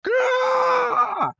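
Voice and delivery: very high screeching squawk; bird squawk. Retold or imitated. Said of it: "And Pidgeotto's like".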